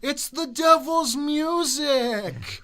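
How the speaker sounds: slow, booming preacher warning; hellfire preacher. Pretend. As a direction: thunder it like a preacher.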